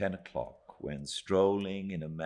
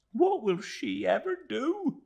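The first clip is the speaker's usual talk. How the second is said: put-on high, louder tearful young woman; sobbing Minnie; 5 dB louder than usual. A narrator's reading. Minnie grieving for Emily, high and tearful. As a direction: plead through tears, high. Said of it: "sobbed Minnie".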